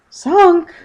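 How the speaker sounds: very high, loud shocked cry; dismayed cry. Acted. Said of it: "cried Paddington in dismay".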